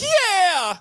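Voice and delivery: very high shouted nasal-free yell, nothing like his own voice. Retold or imitated. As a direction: belt it like a rock frontman. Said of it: "And he talks like this".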